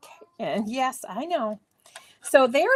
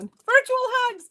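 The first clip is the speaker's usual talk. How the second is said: virtual hugs; 11 semitones higher, 5 dB louder, slower than usual. Real, not acted. Sending hugs to viewers.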